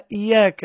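"إياك" with one short Y: iyāka; without shadda. Shown as a demonstration. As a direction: say "إياك" undoubled, straight into the long ā. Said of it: "من غير شدة".

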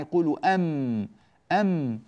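slow أم closed on the lips; أم pressed on both lips. Shown as a demonstration. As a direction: model أم for the class to repeat. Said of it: "الفظوها معي".

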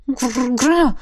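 choppy dragon growl. Acted. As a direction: rumble in short bursts. Драконица рычит. (отрывистое рычание)

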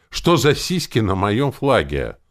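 slow, loud, high-pitched question; indignant demand. A narrator's reading. Hero challenging Rex about the flag, indignant. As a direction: demand it, drawing out each word.

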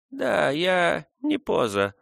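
put-on high, slow old Indian woman; old woman mumbling. A narrator's reading. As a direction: mumble it like a toothless old nurse. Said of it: "ответила старуха".

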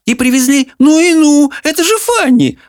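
high, delighted outburst; excited exclaim. Acted. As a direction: burst out in happy surprise. Mrs Fairfax exclaims.

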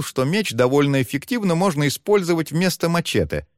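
usual speaking voice

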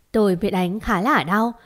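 ordinary delivery

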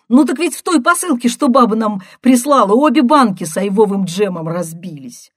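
loud, high, rushing report; agitated sister. A narrator's reading. Sister reporting broken jam jars, loud and agitated. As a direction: blurt out the bad news at volume.

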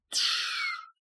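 long hissed "shh"; soothing shush. Acted. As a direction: hush a crying child. Hushing the frightened girl.

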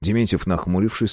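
ordinary delivery, nothing marked

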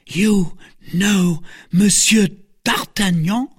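slow, high, incredulous question; high-pitched shocked question, word by word; astonished cry. A narrator's reading. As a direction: spell it out in amazement. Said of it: "cried the novice".

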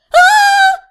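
loud, piercing scream; drawn-out high scream; high-pitched shriek. A narrator's reading. Patient cutting off the healer, loud shrill shriek. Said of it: "завизжала я".

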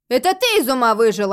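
loud, irritated comeback; snapping retort. A narrator's reading. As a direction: snap back at him loudly. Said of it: "огрызнула женщина".

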